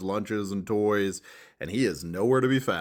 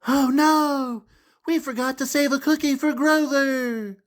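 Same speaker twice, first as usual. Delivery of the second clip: put-on high, wide-eyed kids' voice; high cartoonish children gasping; worried children; 5 dB louder than usual. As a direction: play the little kids fretting. Children voiced in picture book.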